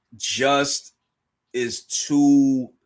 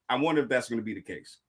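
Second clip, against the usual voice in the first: normal talking voice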